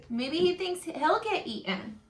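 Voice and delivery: plain reading voice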